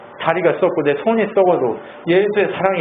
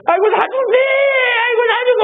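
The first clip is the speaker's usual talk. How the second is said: very high, crying mock wail; old man wailing; 3 dB louder, faster than usual. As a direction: overdo the old man's dying cry. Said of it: "계속 이래요". (아주 높게 죽겠다고 우는 할아버지 흉내)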